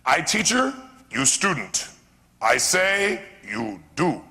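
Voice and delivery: clipped broken English of a wise teacher, lightly voiced; Miyagi imitation. Teacher laying down rules to his new student, lightly imitated Miyagi. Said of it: "he said".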